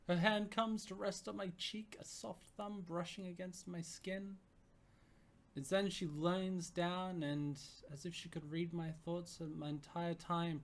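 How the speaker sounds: narrating a tender first kiss; story narration. A narrator's reading. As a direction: linger over the kiss. Reads story narration aloud.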